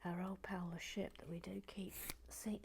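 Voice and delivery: soft unvoiced whisper while building. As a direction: breathe the words, barely voiced.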